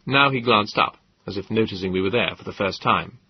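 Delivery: usual speaking voice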